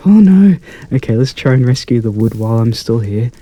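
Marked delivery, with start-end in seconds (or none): 0.1-0.6 loud, high cry of dismay. Real, not acted. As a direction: blurt it out in alarm at a mishap. Tree farm just caught fire.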